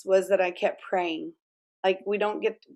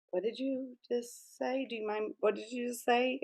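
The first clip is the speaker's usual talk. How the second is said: stunned hushed question; 3 semitones higher, 11 dB quieter than usual. Retold or imitated. Stranger stunned by her phrase.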